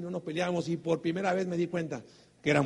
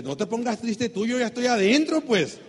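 higher, louder comeback stressing "tú"; réplica indignada; 5 semitones higher, 7 dB louder than usual. Retold or imitated. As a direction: throw it back, building louder on "adentro". Indignant retort to the refusal. (réplica indignada, más aguda y fuerte, recalcando "tú")

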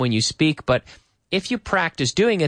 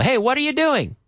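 casual greeting; 10 semitones higher than usual. Shown as a demonstration. Casual example line, high pitch.

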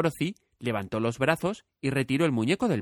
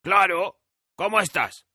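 higher, louder cheerful scarecrow; hoarse scarecrow; 7 semitones higher, 5 dB louder, slower than usual. A narrator's reading. Scarecrow asks Dorothy how she is, cheerful. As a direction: answer brightly from the pole.

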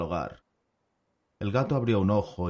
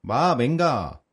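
a loud, higher gruff dwarf's assent; Tapadera agreeing; 6 semitones higher, 6 dB louder, much slower than usual. Acted. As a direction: bark out a hearty go-ahead. (asentimiento enérgico, más alto y fuerte)

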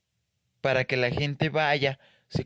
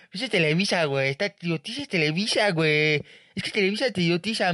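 higher, ranting leftist dude voice; Chairo impression; 4 semitones higher than usual. Chairo complaining to a buddy about Televisa, ranting. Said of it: "ahí tenemos el Chairo".

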